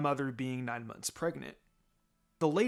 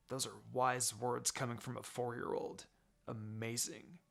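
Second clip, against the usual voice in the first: deadpan mock praise, quiet; sarcastic; 3 semitones lower, 10 dB quieter than usual. A narrator's reading. Kakashi mocking the four-year-old, sarcastic and quiet. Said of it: "he said sarcastically".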